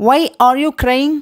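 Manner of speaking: very slow, words drawn apart; slow model phrase. Shown as a demonstration. Teacher to Tamil learners, slow clear model question. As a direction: lay it out slowly for learners. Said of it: "teacher models English phrase twice".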